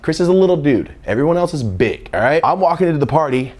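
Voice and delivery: normal talking voice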